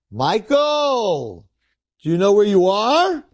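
high, drawn-out booming summons; heavenly judge. Acted. Rabbis in heaven address dead Michael, grand high calling.

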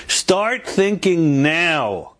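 slow, high, drawn-out urging; urgent exhortation. Real, not acted. Speaker urging listeners to find a guest, slow high exhortation. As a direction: urge them, stretching each word high.

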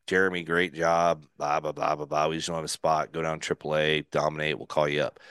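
low, monotone recital of bad news; rote dismissal speech. Skipper sending pitcher down to triple A, rote and low. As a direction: drone through it like a routine brush-off. Acts out expected demotion speech.

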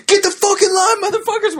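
mock scream, pitched far up; high-pitched hollering impression of angry convicts; retold screaming. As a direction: imitate inmates screaming orders at newcomers. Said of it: "dudes just screaming at us".

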